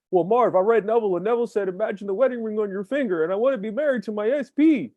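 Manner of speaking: pitched well up, naive mock client.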